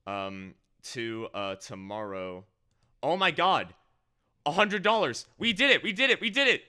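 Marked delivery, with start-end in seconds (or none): 3.0-6.6 much higher, louder, giddy cheering; giddy relief. Real, not acted. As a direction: celebrate like the goal just hit. Donation goal reached on stream.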